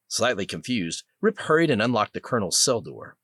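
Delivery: usual speaking voice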